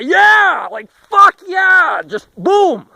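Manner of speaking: slow, roaring high fuck yeah; crowd cheer. Acted. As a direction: yell it like a stadium crowd.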